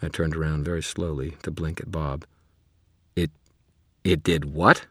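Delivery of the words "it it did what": stunned disbelief; shocked. Acted. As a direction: hit by bad news. Shock at news.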